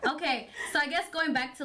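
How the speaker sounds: talking through a laugh; words breaking into a chuckle. Real, not acted. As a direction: still laughing as she moves on. Laughing after accent banter.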